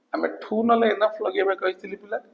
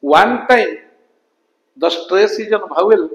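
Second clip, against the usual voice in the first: slow dictation; 4 dB louder, much slower than usual. Shown as a demonstration. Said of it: "ଦେଖ ପିଲା".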